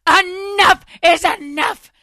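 hammed-up slow yell of a fed-up mom; furious mom. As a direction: drag out every word, yelling at the noisy neighbors. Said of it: "she lost her shit on them".

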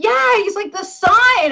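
high, eager retold outburst; excited recognition. Star spotting the sign he heard about, excited. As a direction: imitate someone suddenly recognising it, pitch jumping.

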